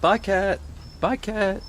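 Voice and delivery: high, sing-song goodbye to a cat; talking to a cat. Real, not acted. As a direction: pitch it way up for the cat.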